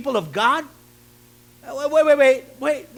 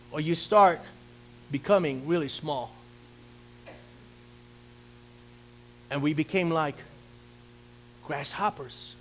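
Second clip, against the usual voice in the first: fading from spoken to near silent, lower and softer; shrinking to small; 8 semitones lower, 8 dB quieter than usual. Shown as a demonstration. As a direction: voice sinks lower and much softer.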